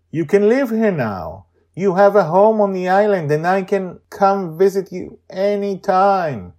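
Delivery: put-on high, loud child's voice; Joy's voice. Joy telling the tortoise its new home, high and eager. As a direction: speak like an eager girl.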